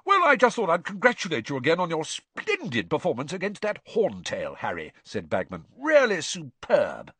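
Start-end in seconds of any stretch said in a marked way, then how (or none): 0.0-4.9 high, effusive character voice; hearty Bagman. A narrator's reading. Bagman congratulates Harry on his performance, hearty and effusive. 5.8-7.1 high, loud, drawn-out praise; hearty Bagman. A narrator's reading. Bagman praises Harry's dragon performance, effusive and hearty. Narrator voices Bagman congratulating.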